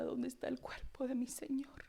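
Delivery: a hushed, grieving whisper; whispered lament of Mary; whispered plea. Acted. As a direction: whisper it like a weeping woman. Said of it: "Lloraba, hermanos".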